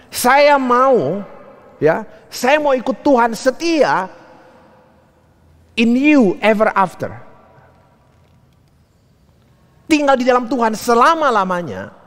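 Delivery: high, slow preacher-like declaration; retold fervent vow. Mother vowing to pastor she will follow God, retold fervent vow. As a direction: proclaim the acted vow in long, high phrases.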